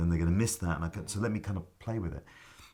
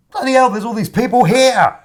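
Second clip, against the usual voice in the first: much higher and louder, laughing through it; shocked exclamation; 16 dB louder than usual. Retold or imitated. Actor seeing the packed house, laughing loud shock. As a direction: blurt it out in amazed disbelief. Stunned by the full audience.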